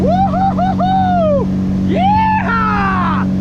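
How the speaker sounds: very high, drawn-out woo-hoo then yeehaw; high whooping holler. Real, not acted. Whooping heading to next pot.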